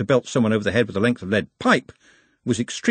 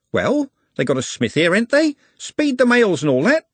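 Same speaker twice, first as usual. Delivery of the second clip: higher, louder scoffing driver; impatient driver; 11 semitones higher, 3 dB louder than usual. A narrator's reading. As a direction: needle the man about the delay. Driver presses the ostler.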